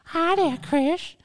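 put-on high, slow leering old man; Herbert the pervert. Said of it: "do Herbert the pervert".

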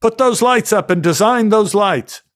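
hammy barked orders; bossy director. Retold or imitated. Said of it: "say to the costume designer".

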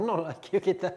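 laughing. Real, not acted. Amused by insulting comment.

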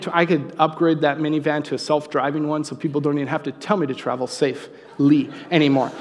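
ordinary talk

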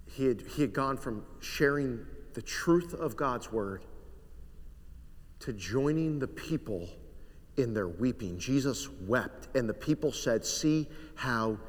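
soft, low reading of the weeping scene; hushed scripture reading. Acted. Reads the scripture softly.

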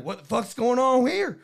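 mock Southern bystander; Southern accent. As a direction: demand answers in a Southern twang. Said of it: "Also Southern".

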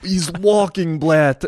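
hammed-up Ukrainian accent. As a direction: imitate a Ukrainian soldier's accent, half laughing. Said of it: "in his Ukrainian accent".

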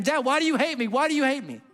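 high-pitched mock yell of a hurt child; retold yell. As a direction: cry out up high like a scared little boy. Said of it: "I would literally yell at him".

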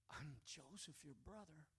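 almost whisper it, like a secret finally told; hushed reveal. Acted. Joseph softly telling his brothers who he is, hushed and tender.

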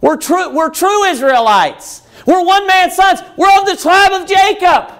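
high-pitched, emphatic protest; insistent pleading. Acted. Israelites appealing to their heritage, pleading insistently. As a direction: press your pedigree as a plea. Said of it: "That's like them saying".

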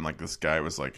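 ordinary talk, nothing marked

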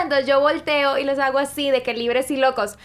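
ordinary talk

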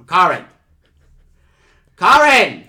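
shouting a name across the room; calling out. Real, not acted. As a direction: yell for Karen to come. Calling out to Karen.

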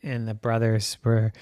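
plain reading voice

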